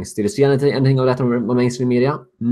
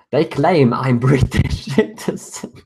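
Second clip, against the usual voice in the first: laughing; 4 semitones higher, slower than usual. Real, not acted. Laughing at the doxxers' mistake.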